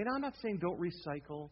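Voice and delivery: normal talking voice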